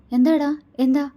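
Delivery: alarmed, urgently asking what's wrong; worried. A narrator's reading. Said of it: "ആകുലതയോടെ ചോദിച്ചുകൊണ്ട്". (ആകുലതയോടെ)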